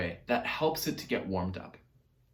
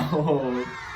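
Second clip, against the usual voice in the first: laughing. Shown as a demonstration. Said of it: "say hello to Baby Owl".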